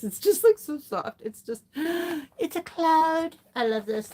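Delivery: high, slow, breathless awe with a gasp; dreamy gushing over the yarn; gushing delight. Real, not acted.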